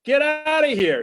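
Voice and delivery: loud high bartender shout. Acted. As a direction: yell it out like a bartender. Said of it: "shouts the bartender".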